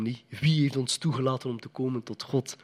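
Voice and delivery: normal talking voice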